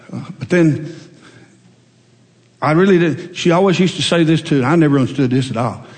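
normal talking voice

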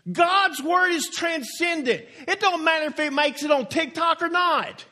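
rising preacher's cadence, driving each line home; preaching. Acted. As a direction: preach it to the congregation. Preacher building to full force.

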